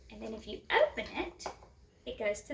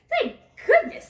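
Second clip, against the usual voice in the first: much higher and louder, laughing relief; relieved laughing cry; 13 dB louder than usual. A narrator's reading. Woman relieved cheer.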